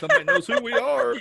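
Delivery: high, thrilled and giddy; excited disbelief. Retold or imitated. Host echoing their first recognition, giddy and high. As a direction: squeal it like fans finally found you.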